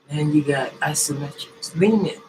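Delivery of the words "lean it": sudden high loud command; coaching shout. Shown as a demonstration. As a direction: bark the cue like a coach. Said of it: "coach cue".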